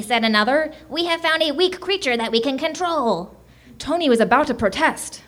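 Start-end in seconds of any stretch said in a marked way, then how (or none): 0.9-3.3 higher-pitched little alien captor; lightly voiced, triumphant creature; a hint of gloating conqueror. A narrator's reading. Alien captor gloats over weak human.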